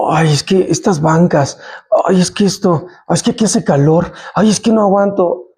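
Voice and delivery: whiny low muttered complaints; mock whining. Pastor mocking members who grumble in church, whiny mock complaint. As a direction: mimic a grumbling churchgoer, sighing.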